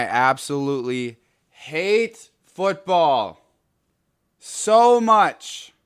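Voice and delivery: fed-up football fan boiling over; angry rant. Real, not acted. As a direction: drag out each word, building to an exasperated peak. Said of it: "I'm just angry".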